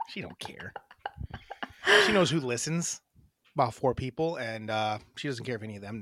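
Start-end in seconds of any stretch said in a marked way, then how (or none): 0.1-0.7 soft unvoiced aside; whispering. Real, not acted. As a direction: whisper it behind her back. Whispered aside about Miranda.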